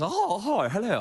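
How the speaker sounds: lightly mocking posh greeting, swooping down; upper-class people. Mimicking garden party guests to the audience, upper-class send-up. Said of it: "upper class people".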